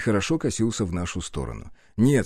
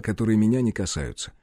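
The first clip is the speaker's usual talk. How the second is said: usual speaking voice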